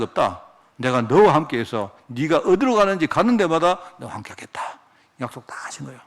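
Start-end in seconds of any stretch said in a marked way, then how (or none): 4.0-6.0 sudden whisper. Real, not acted. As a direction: drop to a breathy whisper, much softer. Preacher drops to a hush. (속삭이듯 조용하게)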